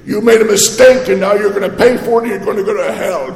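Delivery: condemning voice, rushing the threat; strict God. Pretend. Preacher mocks a strict punishing God, stern scolding. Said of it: "is God the strict".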